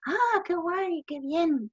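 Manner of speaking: high, bright-eyed interest; impressed. Retold or imitated. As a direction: sound genuinely impressed. Impressed Berlin stranger.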